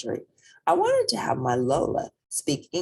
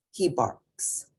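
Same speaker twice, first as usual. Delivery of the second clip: plain reading voice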